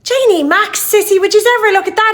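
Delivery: put-on loud, high, fast Dublin kid; excited Dublin child yelling about fireworks; Dublin accent. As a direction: shout it like a real Dubliner. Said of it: "a real Dubliner... she would say".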